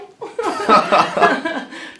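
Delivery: embarrassed laugh. Real, not acted. Laughs after her meow.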